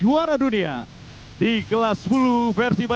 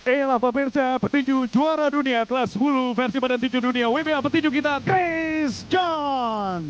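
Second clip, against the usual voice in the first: rising higher to a shouted name; hype announcer build-up; 4 semitones higher than usual. Real, not acted. (makin tinggi lalu berteriak menyebut nama)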